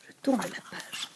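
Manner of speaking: hushed whisper, so as not to wake her; whispered aside. Real, not acted. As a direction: breathe it out softly. Whispered page-turn aside.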